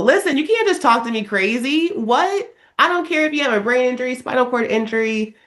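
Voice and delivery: high-pitched, acted protest; imitated incredulous scolding; indignant telling-off. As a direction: set them straight, pitch up. Acted telling-off of rude patients.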